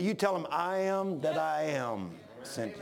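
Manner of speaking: low, slow, solemn God voice; God speaking with slow authority. Retold or imitated. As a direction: proclaim it slowly and gravely. God voiced answering Moses.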